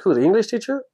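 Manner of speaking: surprised. Retold or imitated. Man surprised by the president.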